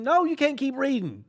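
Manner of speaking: high, loud mock outrage; outraged no. Comic telling off the overeager kid, loud and high.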